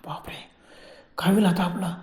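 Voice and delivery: a breath of a whispered bapre; a whispered gasp as a foot pins his back; frightened whisper. Retold or imitated. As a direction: barely voice it, gripped by fear. Terrified gasp of dread.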